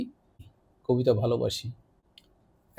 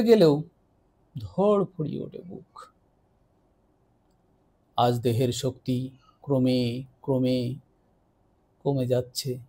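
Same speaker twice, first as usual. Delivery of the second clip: poetry recitation. Acted. Poet reads their own love poem to listeners, expressive recitation. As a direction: recite a love poem with swelling and falling pitch.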